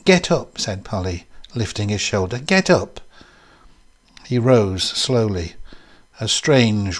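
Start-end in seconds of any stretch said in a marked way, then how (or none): 0.0-0.6 high, sharp order; raised, insistent command; urging command. A narrator's reading. Polly orders him to rise. 2.4-3.0 high-pitched order; insistent, raised-pitch command; urging command. A narrator's reading. Polly repeats the order.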